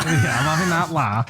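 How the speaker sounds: laughing mock Scouse echo; hammed-up Scouse line through a laugh; Scouse accent. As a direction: repeat it as a Liverpool lad, half laughing. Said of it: "Because he's a Scouser".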